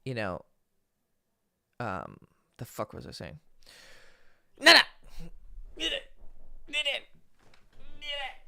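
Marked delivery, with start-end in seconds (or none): 4.6-8.4 high sharp get down to the cat; scolding the cat. Real, not acted. Streamer shooing his cat off the desk, high and sharp. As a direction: shoo the cat off, pitched way up.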